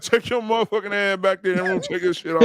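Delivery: mildly hammed-up stern dad order; a touch of comedian-dad outrage; Bernie Mac. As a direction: order her back like a comic angry dad. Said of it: "I told her just like that".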